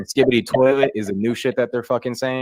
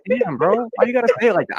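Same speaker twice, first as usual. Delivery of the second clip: mock offended; 13 semitones higher, 5 dB louder than usual. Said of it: "I was like".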